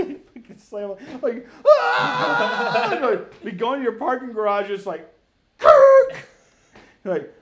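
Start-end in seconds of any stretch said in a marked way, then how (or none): 1.7-2.9 loud high scream breaking into laughter; mock scream. Retelling the brakes failing again, loud laughing scream. Imitating scream as car slams. 5.6-6.1 loud, high, abrupt screech; imitated brake screech. Friend imitating the car stopping in the garage, loud screech.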